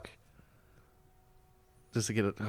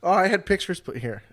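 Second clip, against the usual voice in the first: usual speaking voice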